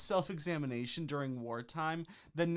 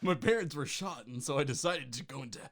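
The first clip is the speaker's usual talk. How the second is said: lightly brooding Batman voice; a hint of brooding hero; tortured artist. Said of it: "my tortured artist voice".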